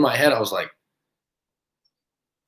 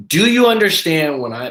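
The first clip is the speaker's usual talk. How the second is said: condescending; 6 semitones higher, 5 dB louder than usual. Retold or imitated. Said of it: "the condescending voice and talking over me".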